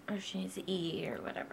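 soft whisper. Real, not acted. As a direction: whisper quietly. Whispering aside.

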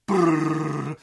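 lips buzzing a loud brrrr; vibrating buzz. Retold or imitated. Imitating the quivering knife with a loud lip buzz.

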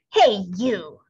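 bossy little bug calling out; grouchy ladybug. A narrator's reading. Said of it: "said the grouchy ladybug".

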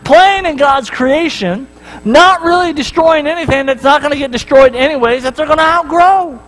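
high, fast-paced inner voice; excited self questioning. Retold or imitated. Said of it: "I'm thinking to myself".

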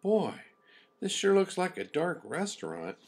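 put-on high, uncertain girlish voice; lightly voiced worried lamb; young lamb voice. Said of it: "Loretta quietly said".

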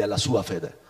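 normal talking voice

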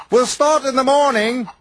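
a kindly driver planning the day. A narrator's reading. The driver speaks to Bulgy.

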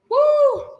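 high cheering whoop; excited woo. Real, not acted. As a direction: whoop to pump up the class. Coach cheering during kicks.

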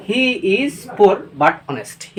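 "He is poor but honest" read very slowly, word by word; teacher dictating the example; very slow dictation. Shown as a demonstration. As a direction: dictate it for students to write. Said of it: "উদাহরণের সহিত দেখব।".

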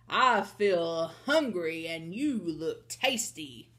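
slow, menacing giant voice; drawn-out giant threat; roaring giant. Acted. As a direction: savor it as a hungry giant. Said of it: "he roared".

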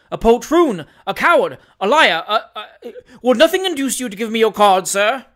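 loud, spluttering tirade of insults; furious. Acted. Doctor demanding the stranger's card, furious. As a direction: stammer with mounting outrage. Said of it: "gasped the furious doctor".

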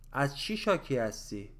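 quick, run together in one breath; natural conversational speed. Shown as a demonstration. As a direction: say it fast like everyday talk. Same phrase at natural speed. (سریع و روان، با سرعت محاوره)

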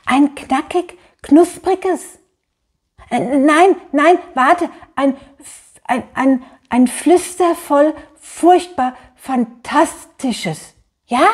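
high, excited furry-pig voice, stumbling over words; high creature voice savouring each adjective slowly; lisping creature. A narrator's reading. Furry pig ordering a tasty book, lisping and eager. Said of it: "lispelt das Pelzschwein".

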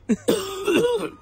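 giggle. Real, not acted. Laughing at the story.

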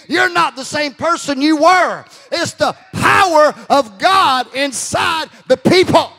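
loud, driving preacher's voice; thundering pulpit delivery; full-force preaching. Real, not acted.

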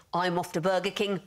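ordinary talk

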